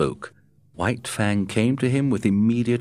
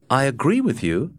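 lightly voiced, higher Matt; a hint of Matt, pitched up; Matt's voice; 6 semitones higher than usual. A narrator's reading. Matt telling Scott the wolf knows, lightly voiced higher. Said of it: "was Matt's answer".

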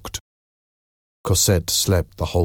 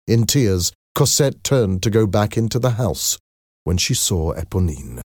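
usual speaking voice

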